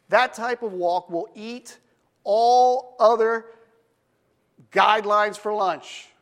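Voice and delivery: emphatic preaching. Real, not acted. Preacher driving home walking with God, emphatic preaching. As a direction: drive each phrase home, high and strong.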